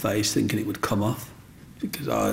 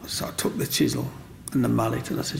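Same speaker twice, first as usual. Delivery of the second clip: normal talking voice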